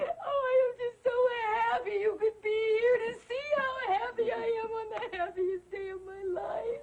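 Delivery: sobbing bride, gushing through tears; happy crying. Acted. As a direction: bawl with joy, drawing out the end. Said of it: "If she gets any happier, she's gonna drown herself".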